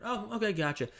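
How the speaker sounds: high-pitched cheery acknowledgement; positive, bubbly tonality. Shown as a demonstration. Agent wrongly answering a worried client, bubbly and positive. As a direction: brush past it with upbeat friendliness. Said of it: "it's this positive tonality".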